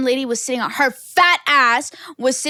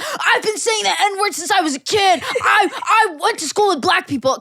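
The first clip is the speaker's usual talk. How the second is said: mocking know-it-all; 7 semitones higher than usual. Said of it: "they're like".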